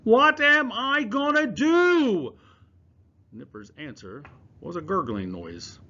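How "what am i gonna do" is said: loud, high, slow anguished scream; drawn-out wail of helplessness; desperate scream. Acted. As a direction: scream it up to the pigeon in despair. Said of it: "screamed up at the pigeon".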